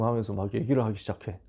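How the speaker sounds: a hushed, toneless whisper, 얘기를 falling away; a quick whispered aside. Retold or imitated. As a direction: breathe 하면서 막 얘기를 하기 시작해 as a whisper. Aside while retelling tongues.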